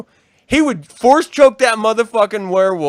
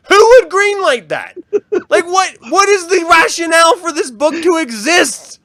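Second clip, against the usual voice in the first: loud, high, disbelieving rant; incredulous outburst; 8 semitones higher, 5 dB louder than usual. Real, not acted. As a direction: blast the ridiculous idea. Outraged at book idea.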